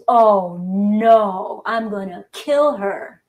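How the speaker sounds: a half-joking loud groan of dismay; dismayed client. Retold or imitated. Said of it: "one woman goes".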